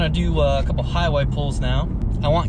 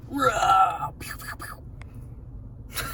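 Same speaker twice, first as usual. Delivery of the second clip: toy blaster sounds; 6 semitones higher, 6 dB quieter, much slower than usual. Pretend. Playing with the figure.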